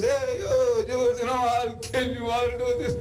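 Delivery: hammed-up swaying giant's boast; swaying giant taunt. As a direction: taunt while swaying on rough ground. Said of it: "he says".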